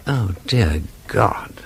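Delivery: slow, breathy whisper; shocked whisper. Acted. Detective hears that a woman was found dead, stunned whisper. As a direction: let the horror drain the voice. News of a murder.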